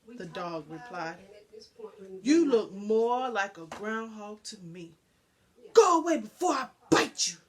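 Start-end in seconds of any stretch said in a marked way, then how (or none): 2.2-7.4 loud, angry threat, rushing at the end; yelling. Acted. Big dog yells at the stray to go away, loud and angry. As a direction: bark it out to chase him off. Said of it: "The dog never heard such yelling".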